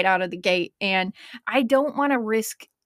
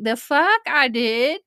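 louder, higher mock disbelief; shocked; 4 semitones higher, 5 dB louder, slower than usual. Host retelling finding the posted episode, shocked. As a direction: retell the startled double-take. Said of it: "And I was like".

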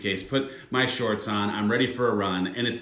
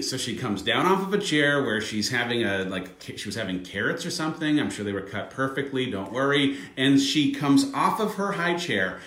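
usual speaking voice

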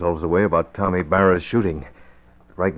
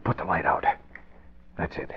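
softer, hushed urgency; 7 dB quieter than usual. Acted. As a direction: hero hushing his partner in hiding. Hiding in dark office.

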